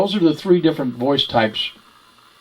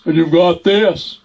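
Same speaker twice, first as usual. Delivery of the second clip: chest voice; 5 semitones higher, slower than usual. Shown as a demonstration. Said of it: "the three you can create voices with".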